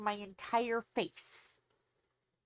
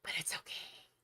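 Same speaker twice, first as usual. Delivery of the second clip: whispered aside; 8 dB quieter than usual. Real, not acted. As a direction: murmur it under the breath.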